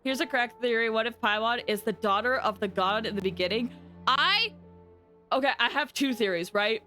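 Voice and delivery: ordinary talk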